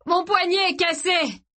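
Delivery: high, slow, strained cry; high-pitched distressed howl; yell. A narrator's reading. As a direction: yell it out over the chaos. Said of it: "hurla Gray dans le chaos".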